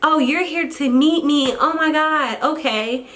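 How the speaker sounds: high, flustered oh-oh-oh realisation; flustered doctor. Retold or imitated. Scene: doctor realises the mom came to meet her, flustered and high. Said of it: "She was like".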